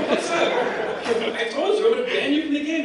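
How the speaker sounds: high, loud mock outrage; exasperated scolding. Lord British confronts the repeat thief, loud and exasperated.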